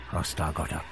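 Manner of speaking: usual speaking voice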